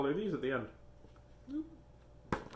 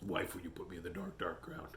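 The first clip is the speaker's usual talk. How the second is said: much lower, much softer growl of a cartoon devil; Tasmanian Devil; 9 semitones lower, 12 dB quieter than usual. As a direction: rumble it low like Taz. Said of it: "Let's hear your Taz".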